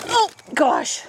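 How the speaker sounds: ordinary talk, nothing marked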